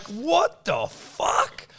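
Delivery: high, drawn-out outraged question; retold disbelief. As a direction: stretch each word in comic disbelief. Retelling shock at to-do list.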